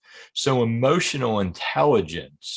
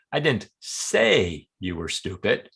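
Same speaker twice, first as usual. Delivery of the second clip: "say" stretched out and lifted, slow; stress on SAY. Shown as a demonstration. Hinting it was written or implied, stress on "say". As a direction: linger on "say", implying another way was used. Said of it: "Maybe I wrote it in an email or implied it".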